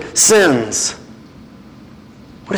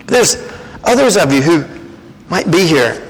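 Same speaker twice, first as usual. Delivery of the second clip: normal talking voice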